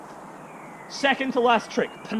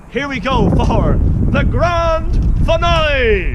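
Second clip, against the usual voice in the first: loud, higher, drawn-out ringmaster call; big showman announcement; 4 semitones higher, 9 dB louder, much slower than usual. Acted. Showman introducing the grand finale to the audience, big drawn-out announcement. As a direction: stretch it out and project to the crowd.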